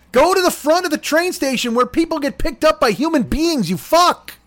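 high-pitched comic tirade; worked-up, insulting telling-off; exasperated rant. Real, not acted. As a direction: scold a friend in a high, worked-up voice. Ranting at friend over pickup spot.

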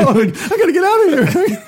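a hysterical passenger shrieking; Airplane woman. Retold or imitated. Host recalls the hysterical woman in Airplane, high panicky falsetto. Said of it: "You sound like the woman in Airplane".

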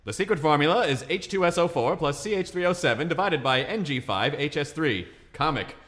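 high, loud vintage comic delivery; mock old-time joke read. Shown as a demonstration. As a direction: read it like a fifties gag book. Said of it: "Next, there is the non sequitur".

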